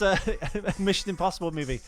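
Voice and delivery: ordinary delivery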